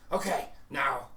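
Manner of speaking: loud, lightly acted steadying himself; Max. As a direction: gather yourself before a risky idea. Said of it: "he goes".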